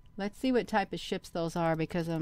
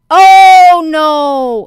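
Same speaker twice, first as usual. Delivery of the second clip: real startled high shout; a long, loud "oh no" bursting out; too loud; 10 semitones higher, 23 dB louder than usual. Real, not acted. Vlogger startled by what the screen shows, too-loud cry. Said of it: "I said it too loud".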